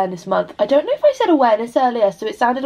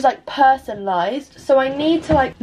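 normal talking voice

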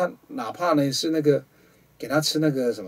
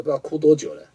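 usual speaking voice

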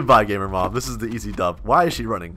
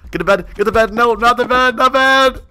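high, loud panicked yell; 9 semitones higher, 4 dB louder than usual. Real, not acted. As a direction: cry out in alarm. Panicked calling out in game.

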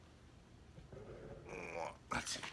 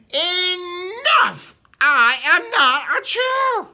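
high, loud outburst; furious shout; 9 semitones higher, 6 dB louder, slower than usual. Acted. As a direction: finally snap and shout it. Giraffe finally speaks up.

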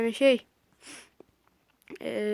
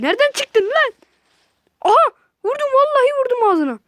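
high and loud, shock into victory; excited battle shouting; startled then triumphant shout; 11 semitones higher, 9 dB louder than usual. Real, not acted. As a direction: yell then celebrate the hit. Sudden enemy, then kill.

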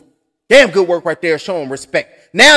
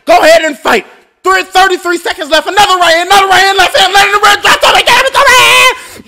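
higher, louder, shouting the knockdowns; excited play-by-play; 8 semitones higher, 6 dB louder than usual. Acted. Streamer calling a knockdown in the last round, excited shouting. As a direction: roar out the count like a ringside caller.

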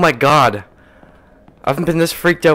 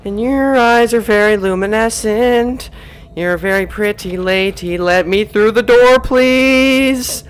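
flirty charmer voice; 8 semitones higher, 6 dB louder than usual. Acted. Flattering a ghost to pass.

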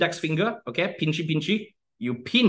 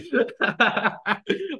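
real laugh; 8 semitones higher than usual. Real, not acted. Laughs at Alvin's comfort.

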